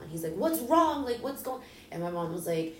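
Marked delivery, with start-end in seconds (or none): 0.3-1.6 high, urgent questioning; startled, raised-voice questions; retold alarm. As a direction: imitate an alarmed brother, pitch jumping up. Alarmed brother on the phone.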